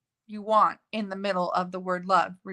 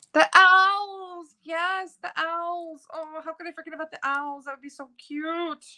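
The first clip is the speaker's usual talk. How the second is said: high, thrilled rediscovery; excited squeal; 8 semitones higher, 4 dB louder than usual. Real, not acted. Crafter remembering her owl stamps, high excited squeal. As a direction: gasp with delight at remembering the owls.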